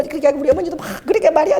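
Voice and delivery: usual speaking voice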